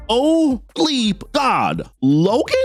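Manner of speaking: high, drawn-out mock astonishment; mock shock. Retelling Kitty recognising Logan, mock shock.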